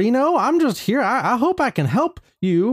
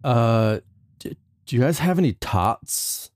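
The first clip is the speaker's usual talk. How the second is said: Napoleon Dynamite voice; 11 semitones lower than usual. Acted. Sulky teen mumbling to his therapist about tots.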